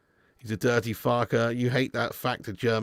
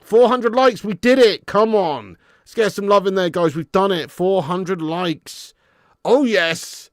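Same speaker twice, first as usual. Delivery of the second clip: loud, high and triumphant; celebrating; 5 semitones higher, 6 dB louder, slower than usual. Real, not acted. Streamer celebrating four hundred likes with viewers, excited. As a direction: cheer the milestone.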